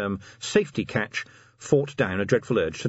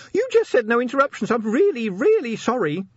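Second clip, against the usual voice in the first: sorry imp; 12 semitones higher, 4 dB louder than usual. A narrator's reading. Imp apologizing.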